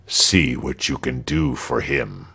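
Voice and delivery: low, casual menace; cool governor. A narrator's reading. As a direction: hand down the order calmly, pitched low.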